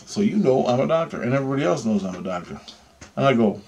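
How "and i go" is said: ordinary talk, nothing marked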